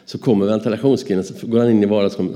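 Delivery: ordinary talk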